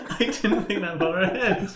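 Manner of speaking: high, giggling embarrassed nerd; laughing nerd. Acted. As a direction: giggle through the admission. Milton caught out, laughing.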